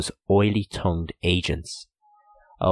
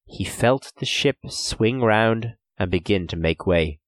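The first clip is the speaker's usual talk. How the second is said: plain reading voice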